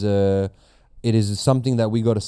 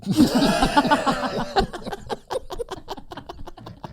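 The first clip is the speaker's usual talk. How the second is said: relieved laugh; 14 semitones higher than usual. Real, not acted. Relief after awkward segment.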